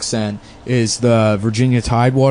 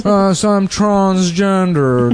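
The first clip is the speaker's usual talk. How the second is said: put-on slow, higher, posh old Boston Brahmin drawl; Boston Brahmin accent; 6 semitones higher, much slower than usual. Joking about Boston Brahmins, slow posh old Boston accent. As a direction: stretch it out like old Boston money. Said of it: "They have that accent".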